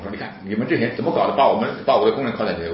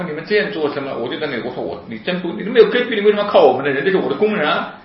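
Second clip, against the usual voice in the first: raised, high-pitched retold outburst; indignant protest; 8 semitones higher, 4 dB louder than usual. Speaker confronts police over his handcuffed workers, indignant protest. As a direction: challenge them in a loud, high voice.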